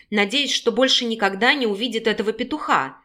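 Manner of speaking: ordinary delivery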